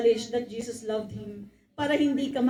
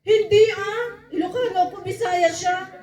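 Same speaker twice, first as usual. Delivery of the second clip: loud protesting denial; 6 semitones higher, 8 dB louder than usual. Acted. Peter loudly claiming a different home province. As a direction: shout him down, deny everything.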